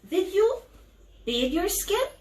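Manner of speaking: ordinary delivery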